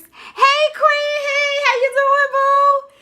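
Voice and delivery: loud, high singsong hello; exuberant greeting. Real, not acted. Host greeting a viewer mid-thought, loud high singsong. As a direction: holler sweetly to a favourite friend.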